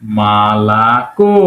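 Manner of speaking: "মালাকো" very slow and low, each syllable held, "ko" rising; slow model pronunciation. Shown as a demonstration. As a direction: stretch out "মালাকো" as ma-la-KO. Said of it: "উচ্চারণ করতে হবে".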